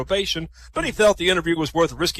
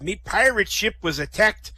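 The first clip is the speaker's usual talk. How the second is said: pirate spinning his sea tale; Sol. Acted. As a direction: tell it like an old sea dog. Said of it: "Sol says".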